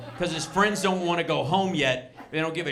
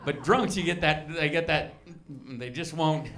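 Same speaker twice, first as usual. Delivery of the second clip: ordinary talk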